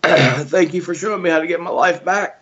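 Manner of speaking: choked up, high and strained, holding back tears; fighting tears while thanking the coach who changed his life; voice breaking. Real, not acted. Member thanking his gym owner, voice breaking. Emotional final message to gym.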